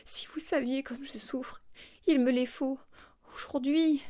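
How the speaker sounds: high, half-whispered begging, rising to full voice; tearful pleading. A narrator's reading. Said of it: "Elle pleurait et bégayait".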